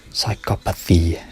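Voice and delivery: Psychopathy said very slowly, syllable by syllable; slow careful correction. Shown as a demonstration. Correcting himself on a tricky word, slow and methodical. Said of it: "try to say it correctly in a slow, methodical way".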